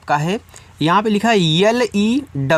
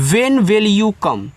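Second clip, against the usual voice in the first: slow and clear, a little higher; slow model sentence; 3 semitones higher, much slower than usual. Shown as a demonstration. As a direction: spell it out slowly for the class. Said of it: "आप बोल सकते हैं". (धीमा, साफ़ उदाहरण वाक्य)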